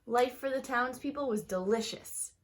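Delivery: lively, expressive storybook reading; reading to children. Acted. Teacher reading a picture book aloud to her students, lively storybook voice. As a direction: read it like story time for a class.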